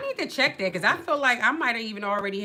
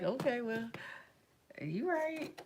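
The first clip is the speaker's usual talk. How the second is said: sheepish concession; 3 semitones higher, 9 dB quieter, slower than usual. Retold or imitated. Host retelling how she conceded to her girlfriend, soft sheepish concession.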